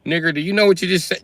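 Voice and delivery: fast, high disbelief; can't believe what he just read; incredulous. Pretend.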